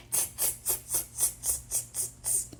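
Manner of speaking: soft unvoiced tss tss; monkey tsking. Monkeys tsking back at the peddler, unvoiced. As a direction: click your tongue like a cheeky monkey. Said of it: "You ready, kids?".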